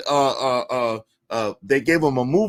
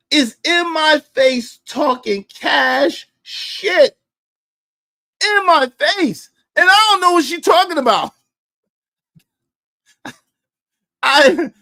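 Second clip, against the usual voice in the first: high, loud, whining cry; hammed-up sob while telling it; mock crying wail; 8 semitones higher, 3 dB louder than usual. Retold crying, voice breaking up.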